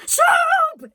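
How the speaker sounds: high, loud defiant shout. A narrator's reading. Cook defying Louise's plea, a loud high shout. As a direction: hurl the forbidden word out. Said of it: "shouted the cook".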